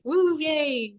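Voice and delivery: high, drawn-out "woo, yay" cheer; mock celebration. Guest joking about falling out of a chair, mock cheer.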